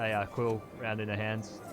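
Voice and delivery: normal talking voice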